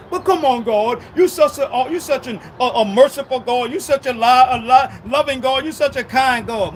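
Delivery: loud, fawning flattery to God; butter up God, overdoing it; loud fawning plea to a merciful God; pleading flattery. Retold or imitated.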